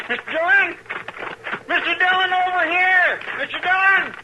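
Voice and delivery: high, loud shout across open ground; hollering to the marshal riding in, loud and high; calling out. Acted. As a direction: yell to be heard far off.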